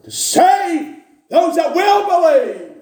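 high, slow and loud; slow preached climax. Acted. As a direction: stretch the words out like a pulpit cry. Preaching at full force.

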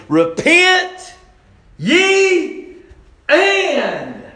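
very high, full-volume cry, word by word; preaching shout. Acted. Preacher quoting Jesus in Mark, emphatic shout. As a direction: thunder each word out separately.

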